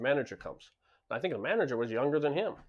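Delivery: usual speaking voice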